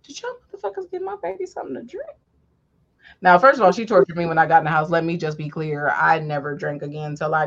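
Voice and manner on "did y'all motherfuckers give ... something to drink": high, fast, furious mom voice; angry mom. Retold or imitated. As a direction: demand it like a furious mother. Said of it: "my mother was like".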